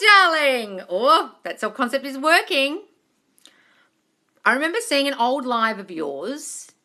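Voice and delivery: usual speaking voice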